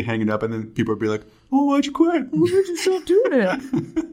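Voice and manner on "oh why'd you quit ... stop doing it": high pleading voice. Retold or imitated. Imitating future listeners' whiny pleas.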